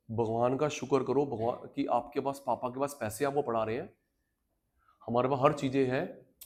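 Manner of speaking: normal talking voice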